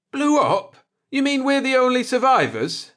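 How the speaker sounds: very high, loud stunned question; shocked disbelief. Acted. Jordan hearing the ship blew up, very high and loud shock. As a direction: recoil at the news in disbelief.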